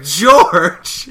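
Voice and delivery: high, very loud shriek of a name; mock indignant yell. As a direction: cry out the name in outrage. Rarity's acted outrage.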